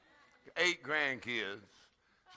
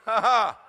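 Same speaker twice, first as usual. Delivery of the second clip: loud, measured "ha, ha, ha"; deliberate laugh; 5 semitones higher, 11 dB louder than usual. Shown as a demonstration. Preacher showing the congregation how to practise laughing, deliberate laugh. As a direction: force out each ha loudly. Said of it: "Practice laughing for a few minutes".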